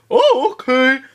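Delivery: lightly voiced bewildered Bolin; dazed Bolin. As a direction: play along, confused. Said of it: "Bolin's like".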